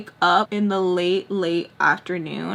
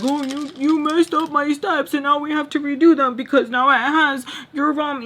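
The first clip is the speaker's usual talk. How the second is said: higher-pitched mimicry of someone whining; mocking complaint; 6 semitones higher than usual. Mimicking someone fussing over messed-up steps, mocking high-pitched complaint. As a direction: imitate a nagging complaint in a raised voice. Acting out the other person's complaint.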